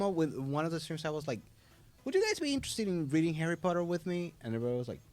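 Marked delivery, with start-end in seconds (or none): 2.0-4.3 higher, quick acted invitation; eager invitation. Streamer asking viewers to read along, eager. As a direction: pitch it up and quick, like asking a group.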